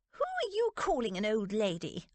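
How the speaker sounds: put-on mildly affronted older aunt; understated affront; indignant demand. A narrator's reading. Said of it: "Aunt Dotty demanded".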